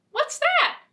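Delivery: a little mole peeking, high and curious; high squeaky mole. Acted. Mole finds the mitten.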